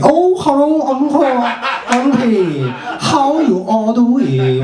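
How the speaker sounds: put-on high, drawn-out Chinese accent; mock Chinese accent. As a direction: greet elders in an exaggerated Chinese accent. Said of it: "like, China and be like".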